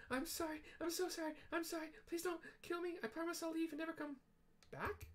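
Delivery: very high, begging squeak, rushing the end; pleading Wedge. Acted. Startled Wedge begs the stranger not to kill him, very high squeak. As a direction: squeal for mercy like a cornered little man.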